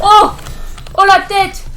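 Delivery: a gamer landing a headshot, cheering loudly. Real, not acted. Headshot reaction.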